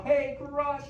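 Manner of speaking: loud, high call to summon Crush; a shouted greeting to get a turtle's attention; yell. Shown as a demonstration. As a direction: call him out from far off. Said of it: "we need to yell".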